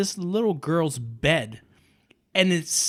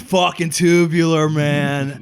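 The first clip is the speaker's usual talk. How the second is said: laid-back surfer dude, a bit louder; 3 semitones lower, 3 dB louder than usual. Pretend. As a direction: play a stoked surfer, laughing through it. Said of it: "Do it".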